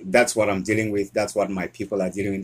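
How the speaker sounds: usual speaking voice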